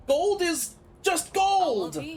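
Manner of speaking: high-pitched, exasperated outburst; exasperated protest. Acted. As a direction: drag out the outburst, then pile on worried questions. Acted quarrel, alarmed at friend leaving.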